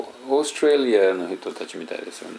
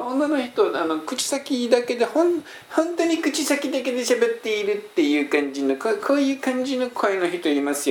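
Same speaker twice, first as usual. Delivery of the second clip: very high-pitched cutesy woman's voice, all from the front of the mouth; cute girlish voice; 11 semitones higher than usual. Shown as a demonstration. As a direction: put on a much higher, lips-only cute female voice. (口先だけの高くて可愛い女性の声)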